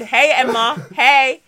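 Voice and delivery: loud mock-friendly hail; knowing retold hello; pointed greeting. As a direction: call out brightly to someone you have caught. Repeats the open-mic greeting.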